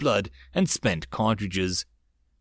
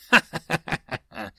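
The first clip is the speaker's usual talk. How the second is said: high laugh; 5 semitones higher than usual. Acted. Laugh after teasing line.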